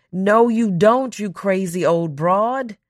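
ordinary delivery

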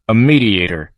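mildly incredulous echo; disbelief. A narrator's reading. Questioner echoes the witness's answer, mild disbelief. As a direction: repeat it as if you can't believe it. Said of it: "looks at him in disbelief".

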